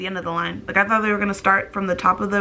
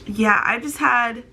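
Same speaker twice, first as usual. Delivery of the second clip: ordinary delivery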